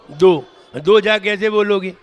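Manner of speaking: usual speaking voice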